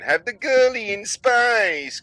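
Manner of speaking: high, drawn-out mocking squeal; mock NASA spokesman. NASA pushing its publicity stunt, high mock enthusiasm. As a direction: stretch it out high, sending up the officials.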